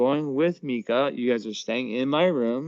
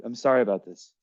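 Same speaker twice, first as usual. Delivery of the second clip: ordinary delivery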